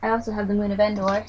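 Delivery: usual speaking voice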